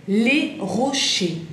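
"les rochers" said very slowly, with a French R and no final R; teacher model of "les rochers", ending on a clean E; slow French "les rochers" for students to copy; silent final letters. Shown as a demonstration. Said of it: "ese final no la pronunciamos".

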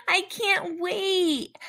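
high, slow, gushing excitement; eager. Acted. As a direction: stretch it out, thrilled. Eager about shopping.

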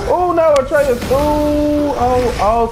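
high, drawn-out dismay; alarmed cry. Real, not acted. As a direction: cry out as your companion goes down. Companion gets knocked out in game.

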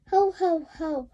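chuckle. Acted. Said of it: "chuckled Daddy Pig".

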